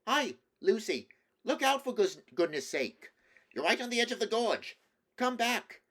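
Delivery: high, loud alarmed shouts; shouting. A narrator's reading. The others shout after Lucy at the gorge edge, shouting. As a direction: shout a warning at full pitch. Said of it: "the voices of the others shouting".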